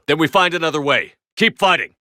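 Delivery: defiant battle cry; rallying shout. Acted. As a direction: push the troops on.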